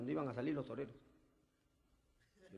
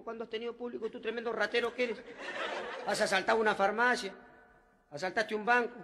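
louder, higher scolding of the thief; scolding cop; 5 semitones higher, 7 dB louder than usual. Retold or imitated. Detective scolding the thief for his crimes, loud. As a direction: tell off the crook loudly. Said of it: "le dice".

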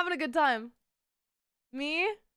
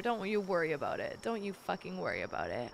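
soft, lower murmur; soothing hush; 7 semitones lower, 7 dB quieter than usual. Real, not acted. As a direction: hush it gently, low and soft. Soothing a dying creature.

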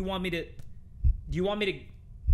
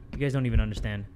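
normal talking voice

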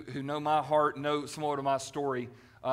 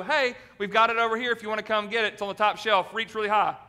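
smug churchgoer; 5 semitones higher, 6 dB louder than usual. Retold or imitated. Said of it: "And we go".

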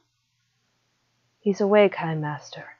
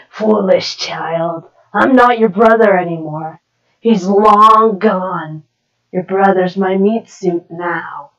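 gloating demon; 3 semitones lower, 4 dB louder than usual. Acted. Demon gloats over the child.

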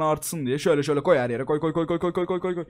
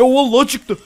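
much higher and louder, thrilled; triumphant cry; excited shout; 9 semitones higher, 10 dB louder than usual. Real, not acted. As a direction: shout when it finally works. Egg finally hatches.

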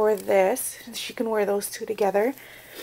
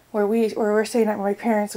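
ordinary talk

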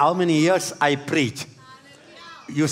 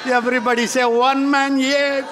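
shouting call and response; 6 semitones higher, 3 dB louder than usual. Real, not acted. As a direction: call it out for them to repeat.

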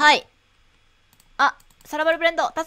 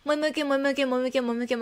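rapid-fire moe moe kyun; fast tongue twister; 3 dB quieter, much faster than usual. Shown as a demonstration. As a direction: rattle it off as fast as possible. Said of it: "どんぐらい早く言えんだろうね".

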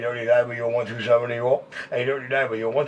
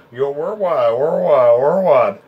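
calling out worldwide, loud and stretched; loud radio call; 3 semitones higher, 6 dB louder, much slower than usual. Real, not acted. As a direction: belt the handle out over the airwaves.

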